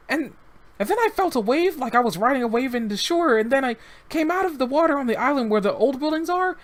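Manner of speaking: put-on high, eager little boy's voice; young boy. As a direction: voice the rescued child, innocent and chatty. Child voice continues.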